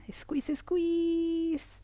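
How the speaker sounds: high, long-held squeeze; sustained high-pitched straining cue. Shown as a demonstration. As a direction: stretch the word out high, as if tensing hard. Coaching tense-and-release exercise.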